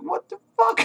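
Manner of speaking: incredulous squeak at the weird scene; a high, stunned what the fuck; baffled disbelief. Real, not acted. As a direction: voice shoots way up in disbelief.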